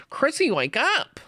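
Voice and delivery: high frantic cry to wake Chrissy; Eddie Munson yell. Retold or imitated. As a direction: shout her awake in a high panicked voice. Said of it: "He's like".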